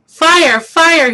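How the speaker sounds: loud high shout of alarm. A narrator's reading. Jack yells at the birds to trick them off. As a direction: yell it to scare the birds off. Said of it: "he cried".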